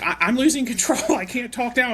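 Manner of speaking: high, loud frantic wail of a swamped teacher; mock panic, voice shooting up; panicked high cry. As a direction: squeal it out like you're drowning in chaos.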